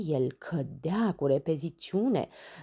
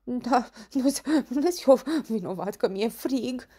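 high, louder chattering-teeth protest; shivering with cold; 8 semitones higher, 5 dB louder than usual. Acted. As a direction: stammer it through shivers. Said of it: "Tremura ca varga și dinții îi clănțăneau". (tremurând de frig, clănțănind)

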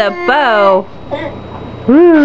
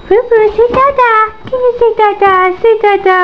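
high sing-song coaxing; 8 semitones higher than usual. Real, not acted. Parent coaxing baby to talk.

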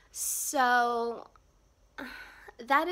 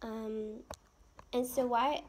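ordinary talk

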